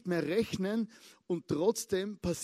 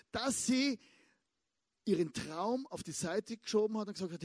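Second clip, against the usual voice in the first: usual speaking voice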